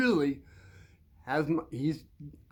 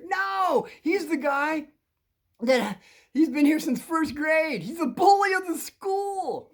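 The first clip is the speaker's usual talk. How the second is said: high, slow, hammed-up warning; dramatic warning; 10 semitones higher, 4 dB louder, slower than usual. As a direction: act out a friend revealing who the dangerous one is. Friend dramatically warns about bully.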